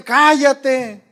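loud, high hushing of someone; imitated shouting. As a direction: shout them down, stretching the word. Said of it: "con gritos".

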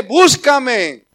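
loud, ringing call; preacher booming God's call to seek him, loud. Acted. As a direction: proclaim it like God's summons.

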